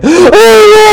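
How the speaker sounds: loud high-pitched hammed-up bawl; fake crying wail. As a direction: wail like a bad soap actress. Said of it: "but it's so fake".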